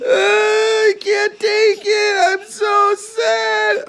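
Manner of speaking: slow, drawn-out fake crying lament; mock wail. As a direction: stretch it out like a hammed-up tragic sob. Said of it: "I'm gonna cry".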